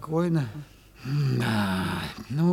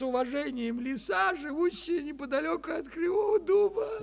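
reading with a crying catch, higher; tearful reading; 10 semitones higher, 4 dB quieter than usual. Acted. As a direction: let the voice break as you finish the note. Butcher reads the fox's mocking note.

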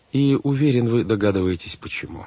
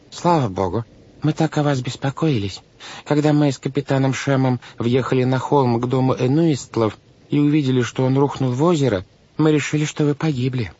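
higher-pitched fussy banker; worried, relieved guardian; 6 semitones higher than usual. A narrator's reading. Banker tells the children he feared them drowned, relieved.

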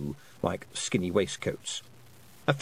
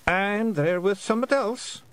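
muttering; 10 semitones higher than usual. A narrator's reading. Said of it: "muttered the twiddler".

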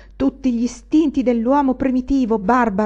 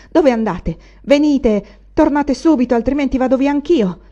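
loud, sharp and higher, calling after her; harsh call; 5 semitones higher, 7 dB louder than usual. A narrator's reading. Anania calling angrily after the old widow. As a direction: bark the threat up the stairs. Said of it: "disse aspramente".

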